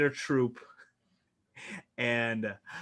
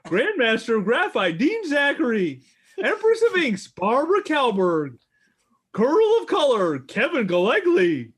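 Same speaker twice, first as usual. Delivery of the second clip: louder and higher, like a ring announcer; grand announcer intro; 4 semitones higher, 5 dB louder than usual. Acted. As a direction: boom out each title. Host introducing the cast grandly.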